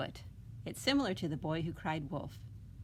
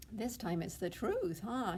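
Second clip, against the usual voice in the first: ordinary delivery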